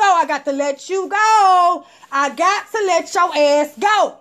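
slow, stretched goodbye; drawn-out dismissal. Retold or imitated. Letting the ex go for good, slow. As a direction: stretch each word out.

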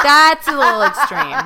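cracking up as you say it; real laughter riding over the words; laughing. Real, not acted. Laughs at her own crude joke.

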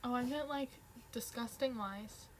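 ordinary delivery

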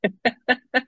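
high, loud giggle; high-pitched laughing fit. Real, not acted. Laughs about drop bears.

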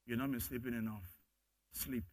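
soft, low voice of God; hushed fatherly reassurance; still small voice. Acted. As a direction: speak as a gentle father at night. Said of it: "You hear that still small voice".

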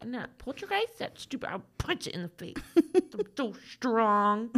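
soft mock macho voice; big strong man. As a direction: play the tough guy, under your breath. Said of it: "I'm a big, strong man".

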